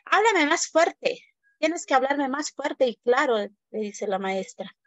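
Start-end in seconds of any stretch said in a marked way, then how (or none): 0.0-3.4 teacher snapping at a shy new pupil; stern teacher. Acted. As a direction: demand he speak up, sharply.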